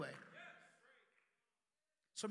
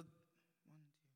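counting under breath; 4 semitones lower, 26 dB quieter than usual. Real, not acted.

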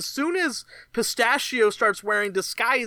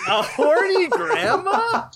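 incredulous shout; 10 semitones higher, slower than usual. Real, not acted. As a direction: shout it back in disbelief. Repeated in disbelief.

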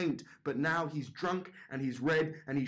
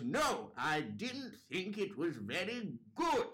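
put-on slow, high old-woman verdict; insistent old judge; 5 semitones higher, 4 dB quieter, slower than usual. Old judge repeating her verdict over the shushing, slow and stubborn. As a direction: dig in, stretching each word with big swoops. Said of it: "And Margaret goes".

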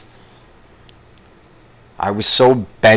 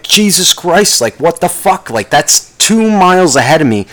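higher, exasperated road rage; enraged in traffic; 6 semitones higher than usual. Retold or imitated. Stuck in dead traffic, cursing the accident icons, enraged. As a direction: vent at the traffic, climbing in pitch. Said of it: "you start to get a little bit enraged".

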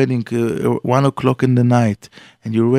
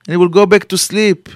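ordinary talk, nothing marked

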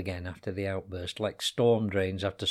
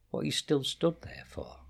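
whisper; 7 semitones higher than usual. A narrator's reading. Narrator voices Shirley calling back.

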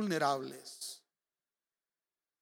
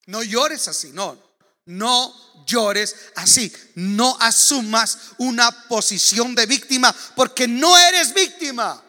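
firm, louder divine rebuke, slow on the last no; God's rebuke, growing firm; 4 semitones higher, 14 dB louder, slower than usual. Retold or imitated. God speaking to the weeping preacher, firm and louder. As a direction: voice God correcting him, rising to louder. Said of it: "Me dice".

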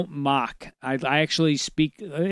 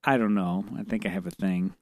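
sheepish; 8 semitones lower, 7 dB quieter than usual. Real, not acted. Sheepish comic admission.

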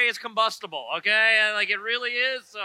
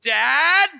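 raised calling voice, rising at the end; a scared kid calling for his father, louder now; louder call for dad; 4 semitones higher, 8 dB louder than usual. Retold or imitated. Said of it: "So it went like this. Second dad".

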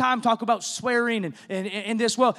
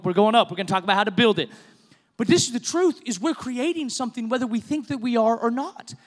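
ordinary delivery

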